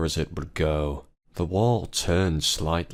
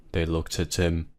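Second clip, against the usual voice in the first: usual speaking voice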